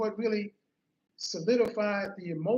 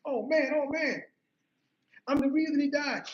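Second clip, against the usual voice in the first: higher, tearful wail acted out; mock crying; 5 semitones higher, 3 dB louder than usual. Grieving teen blaming himself to his sister, mock crying. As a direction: imitate yourself crying with guilt, pitched up. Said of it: "I was crying".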